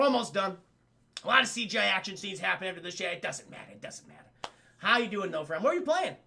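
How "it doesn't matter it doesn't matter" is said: unvoiced whisper aside. Real, not acted. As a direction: whisper it to yourself. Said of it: "said twice, whispered".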